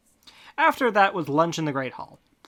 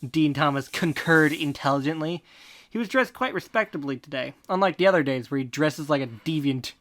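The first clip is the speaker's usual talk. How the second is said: ordinary delivery, nothing marked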